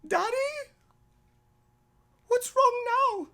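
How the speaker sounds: put-on high, loud child's voice, concerned; worried little girl. Daughter seeing daddy cry again, high and worried. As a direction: ask like a small girl worried for her dad.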